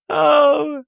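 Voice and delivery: high startled oh; mock shocked. Host reacting to the crying scene, mock shocked. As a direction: gasp it out in amused disbelief. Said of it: "And I was like".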